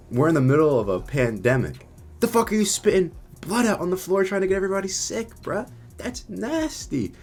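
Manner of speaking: high-pitched indignant rant; disgusted outrage. Retold or imitated. Berating a man spitting blood in a pandemic, outraged. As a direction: re-enact an appalled, disgusted telling-off.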